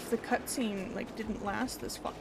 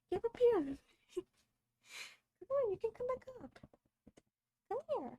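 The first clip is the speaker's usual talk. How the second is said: much higher sing-song dog talk; pet voice; 12 semitones higher, slower than usual. Real, not acted. Streamer calling her dog onto the bed in high pet voice.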